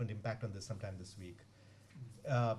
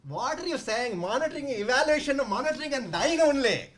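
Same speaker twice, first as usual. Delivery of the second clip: put-on high, loud strong South Indian accent; 11 semitones higher, 8 dB louder than usual. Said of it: "very strong South Indian accent".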